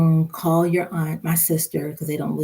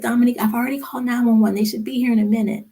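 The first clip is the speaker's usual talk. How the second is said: fast, higher retold urgency; quick retold assurance in an emergency; hurried insistence; 6 semitones higher, much faster than usual. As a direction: rush it out, pitch lifted. Retold urgent word to neighbour.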